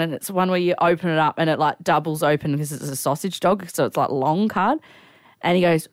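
ordinary talk, nothing marked

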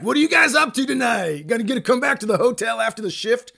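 high, loud party shout, fast at the end; rowdy drunk invitation; out of control. Retold or imitated. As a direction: whoop it up like a wild drunk. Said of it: "He's acting so out of control".

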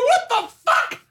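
high, loud, disbelieving yell; incredulous outburst. Retold or imitated. Dad yelling at his daughters in disbelief, loud and high. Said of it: "I go".

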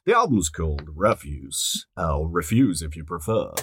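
a light radio announcer read. Real, not acted. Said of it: "I've gone into radio voice".

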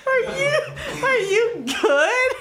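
high helpless laughter through words; can barely talk for laughing; laughing hard. Real, not acted. Friend cracks up at question.

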